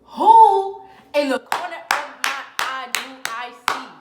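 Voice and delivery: slow, very loud dramatic reveal; outraged shout. Real, not acted. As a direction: bellow it out word by word. Outraged reveal of boyfriend in photo.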